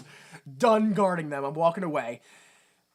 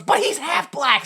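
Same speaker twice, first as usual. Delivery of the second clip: mock retort; 11 semitones higher, 8 dB louder, slower than usual. Mocking retort, high and slow.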